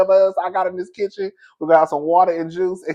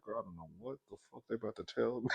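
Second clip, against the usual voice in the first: fast, much softer, half laughing; hushed thought; 5 semitones lower, 20 dB quieter, faster than usual. Retold or imitated.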